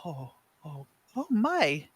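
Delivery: rising shocked gasp; oh my in disbelief; surprised. Acted. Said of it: "eyes wide with disbelief".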